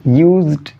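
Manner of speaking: ordinary talk, nothing marked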